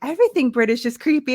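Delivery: ordinary talk, nothing marked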